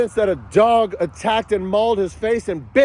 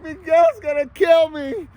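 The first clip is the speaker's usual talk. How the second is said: higher, whiny mock plea for help; mock pleading ex; 5 semitones higher than usual. Mocking his ex begging her family, higher whiny plea. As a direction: parody her fake cry to daddy, pitched up. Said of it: "So she lies to her family".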